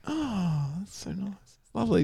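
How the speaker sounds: high, soft, delighted coo; softly thrilled at a chocolate freckle; gushing. Retold or imitated. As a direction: coo over a lovely little treat. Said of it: "I was like".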